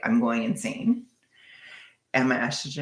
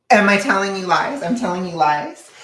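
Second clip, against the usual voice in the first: high, loud playful protest; mock indignation; 6 semitones higher, 8 dB louder than usual. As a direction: push back in raised mock outrage. Playful retort to a teasing chatter.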